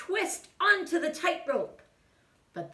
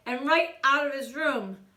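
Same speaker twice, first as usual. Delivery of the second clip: plain reading voice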